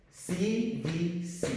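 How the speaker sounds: slow, deliberate C V C; letter by letter for the class; slow spelling. Shown as a demonstration. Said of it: "teacher spells letters slowly".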